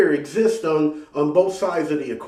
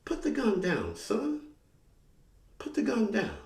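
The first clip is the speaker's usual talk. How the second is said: soft, calm, higher motherly command; 8 semitones higher, 8 dB quieter than usual. Retold or imitated. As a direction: quietly talk the cop down like a mother. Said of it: "she calmly says".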